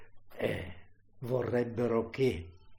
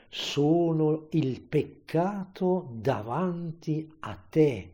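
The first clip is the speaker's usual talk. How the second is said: slow, raised preaching voice; anguished declaration; 4 semitones higher, 3 dB louder, slower than usual. Acted. Preacher as crucified Jesus confessing to the Father, slow and raised.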